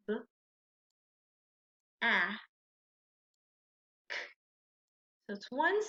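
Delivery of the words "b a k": short isolated sounds "B", "a", "k", long pauses between; one bare sound at a time: "B, a, k". Shown as a demonstration. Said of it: "we're going to build that sound".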